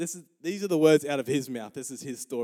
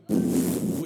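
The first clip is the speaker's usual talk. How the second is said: loud blown-out whoosh of flames; flamethrower whoosh; 8 semitones lower, 6 dB louder than usual. Retold or imitated. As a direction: imitate gas roaring from a bottle. Imitates flamethrower spitting out flames.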